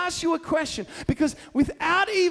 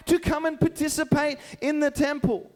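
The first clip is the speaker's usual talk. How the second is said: usual speaking voice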